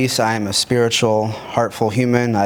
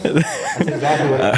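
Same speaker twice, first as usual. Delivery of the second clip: laughing; 4 semitones higher than usual. Real, not acted. Teasing repeat with laughter.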